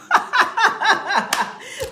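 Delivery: long, high peals of laughter; real laughing fit. Real, not acted. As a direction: burst out laughing in high bursts. Amused that someone doesn't know yet.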